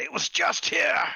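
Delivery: a high, desperate wail to nobody; pleading. Acted. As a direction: beg the empty air, voice shooting up. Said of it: "pleads to nobody".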